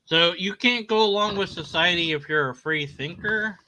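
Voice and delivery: ordinary delivery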